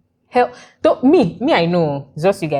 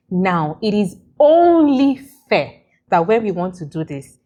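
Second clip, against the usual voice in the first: ordinary delivery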